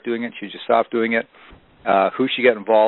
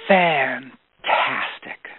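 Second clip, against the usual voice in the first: long, relishing fantastic; drawn-out fantastic; 4 semitones higher, much slower than usual. Retold or imitated. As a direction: stretch fantastic out very slowly, delighted. Ziglar answers cancelled flight calmly.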